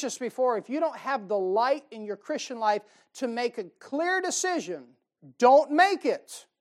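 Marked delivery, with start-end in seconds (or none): 5.4-6.5 slow, loud, high punch; preacher's slow loud warning; emphatic preaching shout. Real, not acted. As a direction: hammer each word home. Preacher hammering home warning.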